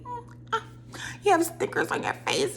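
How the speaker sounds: tiny, high, soft mock baby talk. Mimicking her in a serious conversation, baby voice. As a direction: squeak it softly like a babyish put-on. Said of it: "speaking in a baby voice".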